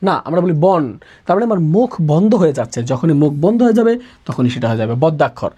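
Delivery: ordinary talk, nothing marked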